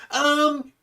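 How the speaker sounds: high, smug, nasal know-it-all; Fedora guy voice. Retold or imitated. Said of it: "why did you do it like the Fedora guy voice".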